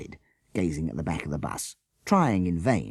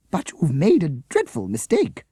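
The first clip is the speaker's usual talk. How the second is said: higher, louder indignant protest; protesting teacher; 10 semitones higher, 6 dB louder than usual. A narrator's reading. Teacher to the policeman, protesting. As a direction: insist he has it wrong. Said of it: "she protested".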